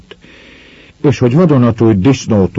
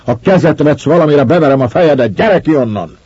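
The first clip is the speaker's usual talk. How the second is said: much higher, louder furious bellow; angry shout from outside; 8 semitones higher, 4 dB louder than usual. A narrator's reading. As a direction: yell a threat across the clearing. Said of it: "fölcsattant Bandila hangja". (kintről felcsattanó dühös kiabálás)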